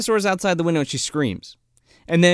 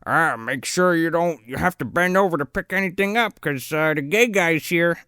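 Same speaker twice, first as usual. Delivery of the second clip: mocking deputy; 2 semitones higher than usual. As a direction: put on a dopey joker's voice. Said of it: "the one guy's like".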